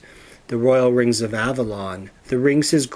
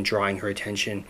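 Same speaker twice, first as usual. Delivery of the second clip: ordinary delivery, nothing marked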